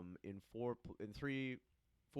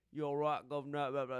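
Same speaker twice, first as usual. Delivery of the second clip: rough mock-Cockney patter; bad Cockney accent; 3 semitones higher, 3 dB louder, faster than usual. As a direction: put on a clumsy Cockney and trail into babble. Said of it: "it's like the Cockney".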